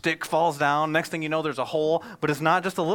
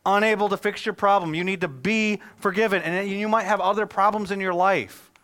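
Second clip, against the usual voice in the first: louder impassioned preaching voice; 6 semitones higher, 6 dB louder than usual. Acted. Pastor urging the congregation they need forgiveness, preaching. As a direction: drive the point home to the congregation.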